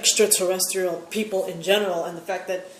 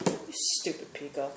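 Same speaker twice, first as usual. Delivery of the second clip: muttered insult; 5 semitones lower, 10 dB quieter than usual. Retold or imitated. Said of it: "I was like".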